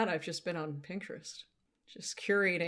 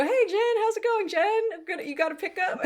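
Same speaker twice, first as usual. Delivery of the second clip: high, loud cheerful greeting; deli workers greeting; 13 semitones higher, 4 dB louder than usual. Retold or imitated. Retelling deli staff greeting her, high and loud. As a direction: call out her name like a regular. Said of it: "They go".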